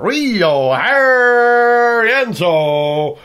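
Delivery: loud, stretched American announcer style; American accent. Pretend. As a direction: boom it out American wrestling style. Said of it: "How would they say that?".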